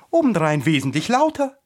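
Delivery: loud, high flower retort; boastfully loud. A narrator's reading. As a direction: show off how loud you are. Tiger-lily boasts of being louder.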